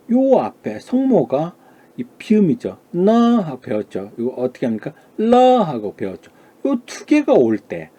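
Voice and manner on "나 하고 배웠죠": ordinary talk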